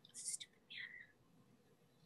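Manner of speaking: breathy whisper under her breath; whispered insult. Acted. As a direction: hiss it as an aside. Woman mutters as she leaves.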